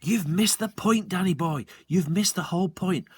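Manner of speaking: a hint of a keen father; father insisting. A narrator's reading. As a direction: press the point on his son. Father voiced, emphatic.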